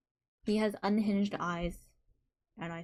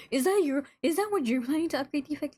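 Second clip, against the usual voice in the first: alarmed surprise; 6 semitones higher, 5 dB louder than usual. Real, not acted. Shocked at viewer's upgrade plan.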